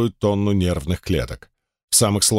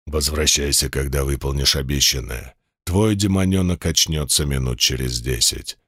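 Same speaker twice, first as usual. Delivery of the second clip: insinuating sinister voice; 8 semitones lower than usual. Acted. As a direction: purr it low like a sinister giant demon. Demon boss voice held.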